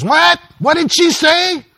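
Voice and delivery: very high, loud, slow husband voice; hard-of-hearing shout. Acted. Husband with dead hearing aid asking his wife, shouting. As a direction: holler it like someone who can't hear.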